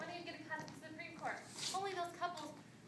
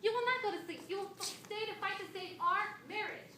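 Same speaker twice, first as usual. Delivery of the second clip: raised, insistent marital demand; angry order; 5 semitones higher, 5 dB louder than usual. Acted. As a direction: lay down the law to a husband. Wife orders husband to stay.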